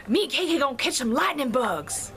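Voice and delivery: hammed-up country Southern twang; thick Southern accent. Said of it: "really genteel Southern accent".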